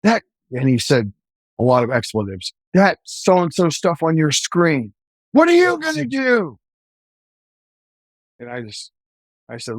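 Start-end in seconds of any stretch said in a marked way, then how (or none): 0.0-0.2 high, rattled retort; a cop shaken by the screaming in the back seat; startled question. Retold or imitated. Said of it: "And I'm like". 2.8-6.6 slow, then a high shouted challenge; retold screaming. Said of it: "And he's screaming at me".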